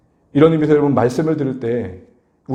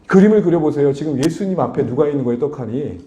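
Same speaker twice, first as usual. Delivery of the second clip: ordinary talk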